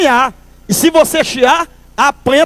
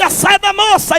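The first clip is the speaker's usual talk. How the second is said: high, insistent doorman call; mock demand; 7 semitones higher than usual. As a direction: call through the door, pitched up. Doorman at bathroom door.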